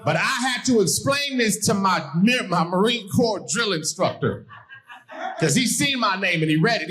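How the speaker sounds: normal talking voice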